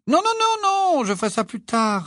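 very high, fluttering no-no-no; hasty refusal. A narrator's reading. Mother turning down a guest's offer of help, hastily. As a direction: wave the offer away in a high, rushed voice.

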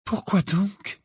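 high, plaintive question; near tears. A narrator's reading. Hermione questions Hagrid's decision, close to tears. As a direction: ask it as if about to cry. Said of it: "qui paraissait au bord des larmes".

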